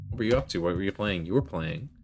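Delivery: ordinary talk, nothing marked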